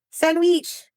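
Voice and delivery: "Sandwich" as "sand-weech", pitched high, mock Arabic accent. Shown as a demonstration. Streamer teaching chat the Arabic word, mock Arabic accent. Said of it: "how to say sandwich in Arabic".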